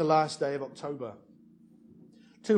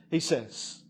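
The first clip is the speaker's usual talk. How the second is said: ordinary talk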